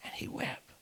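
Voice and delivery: near-whisper after a pulpit shout; soft, solemn hush. Real, not acted. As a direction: drop right down, barely voiced. Sudden hush after shouted climax.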